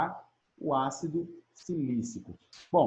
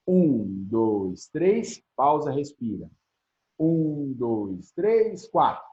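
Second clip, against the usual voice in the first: rhythmic counting; 3 dB louder, much slower than usual. Shown as a demonstration. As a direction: count out in beats with a pause. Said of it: "faz assim". (contagem ritmada com pausa)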